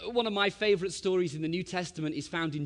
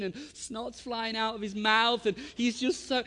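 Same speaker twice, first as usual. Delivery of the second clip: ordinary talk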